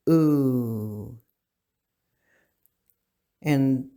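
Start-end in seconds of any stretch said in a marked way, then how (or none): 0.1-1.2 ooh held long for the double U; Ooh, one long round vowel, not two. Shown as a demonstration. Teacher demonstrating the long double U ooh, held out. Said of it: "hold it longer because of the two U's".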